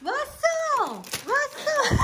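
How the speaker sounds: surprised shout. Real, not acted. Dog does something shocking.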